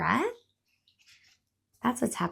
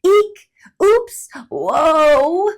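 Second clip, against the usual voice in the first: much louder, high yelps; startled squirrel cries; 9 semitones higher, 12 dB louder, much slower than usual. Acted. Startled squirrel yelps. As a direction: squirrel loses balance on ice.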